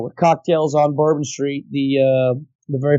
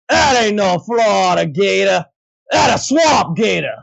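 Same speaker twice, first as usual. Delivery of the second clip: slow, shouted New Orleans drawl; gruff New Orleans voice; 3 semitones higher, slower than usual. Retold or imitated. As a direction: holler it like a Cajun mafia guy from New Orleans. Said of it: "that voice went into my head".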